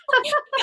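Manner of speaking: high amused laugh. Real, not acted. Co-host laughing at how dark it looks, high laugh. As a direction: burst into a high, real laugh. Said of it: "I'm laughing 'cause".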